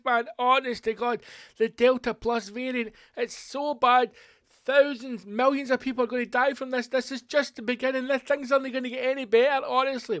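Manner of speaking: high, loud, desperate begging; hammed-up pleading politician; pleading Boris Johnson. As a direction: beg like a panicked prime minister. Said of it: "He'd be like".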